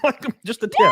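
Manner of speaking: high laughing squeal on the punchline. Real, not acted. Laughing high to a friend about the thumb tip.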